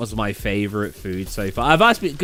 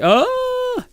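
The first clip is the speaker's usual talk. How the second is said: sudden surprise. Real, not acted. Sudden realization mid-sentence.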